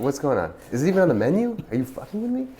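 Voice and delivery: mock outrage, voice climbing; incredulous disbelief. To the waiter about curry, incredulous high-pitched disbelief.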